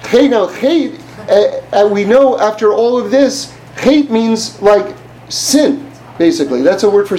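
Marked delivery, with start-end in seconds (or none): 0.0-1.0 imitated yell. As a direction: imitate soccer fans yelling out, lightly. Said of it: "People will yell out".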